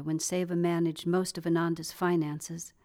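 plain reading voice

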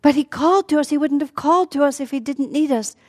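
high-pitched, urgent protest; frantic. Acted. Sita begs Lakshman to answer Rama's call, frantic. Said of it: "Sita becomes frantic".

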